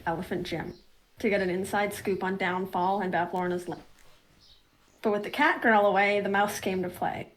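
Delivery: story narration. Acted. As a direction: set the scene for the players. GM recap narration.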